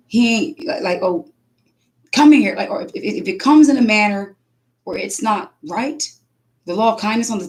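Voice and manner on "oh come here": a loud, higher sharp summons; harsh call. Retold or imitated. Imitating a harsh parent calling the boy over, loud. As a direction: bark it like an impatient parent.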